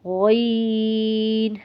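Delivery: عین held long from the throat; drawn-out ayn. Shown as a demonstration. Teacher showing students a long عین. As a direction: stretch عین out slowly. Letter demo.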